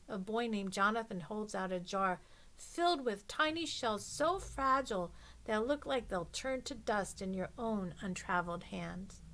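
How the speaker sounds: ordinary delivery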